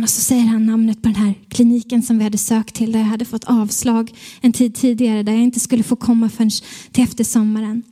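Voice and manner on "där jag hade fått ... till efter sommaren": ordinary delivery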